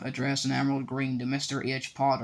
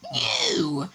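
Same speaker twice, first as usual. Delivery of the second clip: sudden high shriek; 6 semitones higher than usual. A narrator's reading. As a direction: shriek the word out. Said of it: "shrieked Aunt Petunia suddenly".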